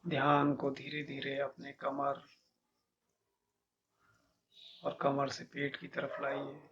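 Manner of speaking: measured meditation guide, long pauses between instructions; guided meditation. Acted. Teacher leading listeners to feel each body part, calm meditation guidance.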